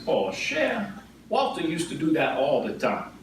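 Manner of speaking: high, put-on old grandma voice, unimpressed; imitating grandmother. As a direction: imitate grandma shrugging off the news. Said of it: "She goes".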